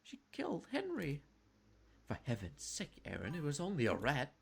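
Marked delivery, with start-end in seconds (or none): 0.0-1.2 put-on much higher, boyish and sullen; young brother blaming his sister, higher; boy's voice. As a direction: bite it out like a hurt young boy. Aaron retorts as a boy.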